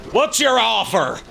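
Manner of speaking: high, loud and slow put-on voice; gruff gatekeeper. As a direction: drag it out like a greedy doorkeeper. Gatekeeper at Dracula's door.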